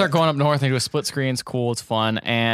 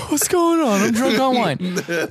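high, giggly drunk impression; hammed-up high-pitched drunkard; mock drunk; 9 semitones higher, slower than usual. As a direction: act tipsy and bewildered, dragging the end. Acts out drunk character at restaurant.

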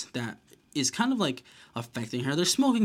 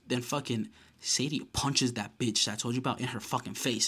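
normal talking voice

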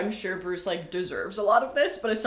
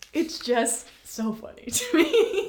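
higher, slow, tickled delivery; laughing; 8 semitones higher, 4 dB louder, slower than usual. Real, not acted. Co-host amused that every guy is cute, laughing. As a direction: let the words dissolve into laughter. Cracking up at the book.

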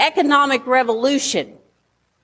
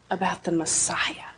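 whispered; 5 semitones lower, 8 dB quieter than usual. Acted. Preacher drops voice for emphasis.

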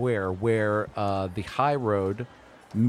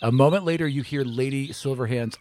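ordinary delivery

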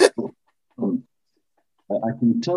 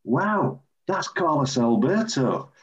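a mild retold gasp of recognition, higher; amazed; 5 semitones higher, slower than usual. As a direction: starstruck at spotting the captain. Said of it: "And I'm going".

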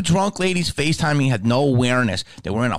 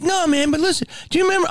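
imitating a clueless fan, much higher; dopey nostalgic fan, pitched up; much faster than usual. Fan ignoring the smell, high dopey voice.

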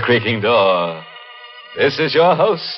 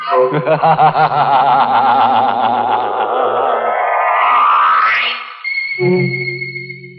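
long creaking villain laugh; slow ghoulish chuckle; sinister cackle; 7 semitones lower, 4 dB louder than usual. Acted. Host's creepy laugh after poem.

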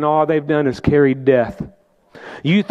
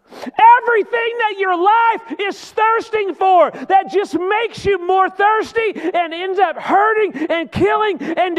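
full-force preaching; 14 semitones higher than usual. Acted. Preacher pressing the congregation, shouting high.

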